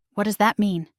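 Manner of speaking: ordinary delivery, nothing marked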